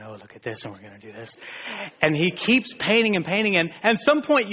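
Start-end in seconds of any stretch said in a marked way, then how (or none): 0.0-1.4 soft, low painter's murmur; quiet, lower delighted painter; Bob Ross voice. Retold or imitated. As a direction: coo over the canvas like the TV painter. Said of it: "talking like this".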